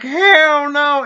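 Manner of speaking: high, loud incredulous hell no; emphatic refusal. Retold or imitated. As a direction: blurt it out in disbelief. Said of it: "I'm like".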